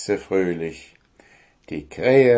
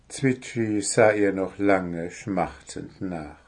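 usual speaking voice

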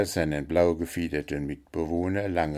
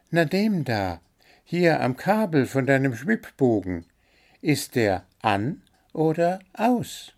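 high, chirpy bird voice listing its complaints; little blue bird, demanding; 6 semitones higher than usual. A narrator's reading. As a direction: play the greedy little bird, pitched up high.

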